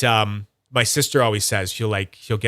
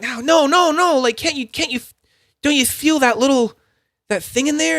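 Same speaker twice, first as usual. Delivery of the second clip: much higher, pleading voice; 4 dB louder than usual. Retold or imitated. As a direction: beg them to feel it. Pleading that they get it.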